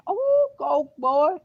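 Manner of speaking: high, squeaky mock voice of cheering classmates; hyped-up friends. Classmates cheering him on as he walks by with his girl, in a high squeaky mock voice. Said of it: "they said".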